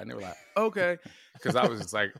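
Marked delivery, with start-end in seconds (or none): 0.6-1.0 put-on high, loud, eager kid voice; kids agreeing. The children answering their dad, eager and loud. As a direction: chirp it like a happy five-year-old.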